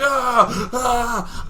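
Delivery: high, acted-out yelps; panicked cry. Wasp escapes the punch, panicked cry. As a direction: yelp in alarm, twice.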